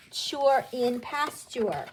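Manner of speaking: "chure" drawn out as CHUR, as in pasture; pronunciation demo. Shown as a demonstration. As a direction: slowly model CHURE in PASTURE with a ch. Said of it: "So we will pronounce it".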